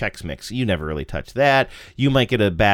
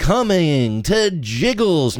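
slow, drawn-out promo announcer voice; radio ad announcer; 7 semitones higher, 3 dB louder, much slower than usual. Retold or imitated. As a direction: hype it like a radio spot. Mimicking radio comedy ad.

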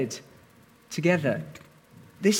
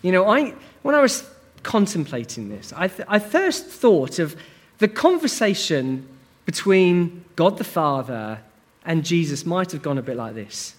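usual speaking voice

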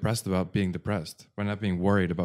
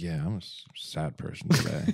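contented sadness; 5 semitones lower, 5 dB quieter than usual. Pretend. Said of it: "enjoy the sadness and be like".

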